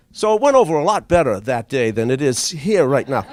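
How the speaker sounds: ordinary talk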